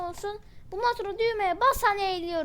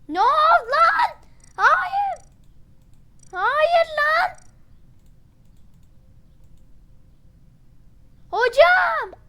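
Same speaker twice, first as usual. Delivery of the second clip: much higher, loud disbelieving yelp; shocked shriek; 10 semitones higher, 11 dB louder, much slower than usual. Real, not acted. Gamer yelping in shock at friends who voted him out. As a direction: scream in outrage at the betrayal.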